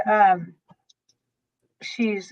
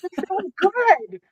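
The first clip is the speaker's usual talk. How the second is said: delighted; 9 semitones higher, slower than usual. Real, not acted. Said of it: "leaped for joy".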